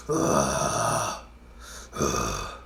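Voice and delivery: demon growl. Retold or imitated. Imitating a mad customer with a deep gorilla grunt. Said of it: "that little demon growl like the women do".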